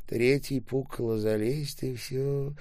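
ordinary delivery

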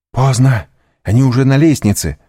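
громкий высокий тревожный ответ; ответь резко, с тревогой; тревожное восклицание. A narrator's reading. Девушка отвечает беглецу в панике. (встревоженно)

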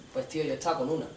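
low, soft mock admission; small, reluctant reply to a mate; sheepish confession. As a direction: admit it under your voice, embarrassed. Sheepish confession acted between mates.

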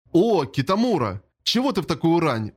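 ordinary delivery

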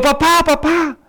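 high-pitched mock child's yell; child's shout. Son wakes at midnight alone, mock alarmed shout. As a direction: imitate a child crying out for dad. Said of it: "le gritó".

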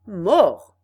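one barked loud word; loud shout. Acted. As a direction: yell the threat. Second robber repeats the threat.

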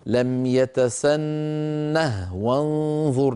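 "يتسنه" recited slowly with the ha kept silent-still; tajweed recitation; slow Quran recitation. Shown as a demonstration. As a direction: chant "لم يتسنه وانظر" at a measured pace. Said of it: "مرت معنا في البقرة".

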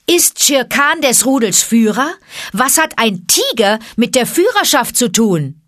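calling out. A narrator's reading. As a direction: throw the question loudly at the crowd, pitched high. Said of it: "rief er". (herausfordernd in die Menge gerufen)

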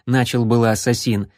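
ordinary delivery

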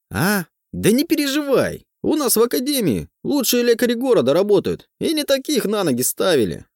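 high, eager cheerful teacher; bubbly, reassuring old teacher; excited teacher voice. A narrator's reading.

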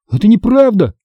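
high-pitched indignant protest; crying out. A narrator's reading. Boy contradicting father, high outcry. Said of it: "вскричал я".

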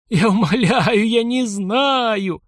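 high, slow pleading wail; desperate begging. Acted. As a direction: beg for your life, drawn out. Victim begs on his knees.

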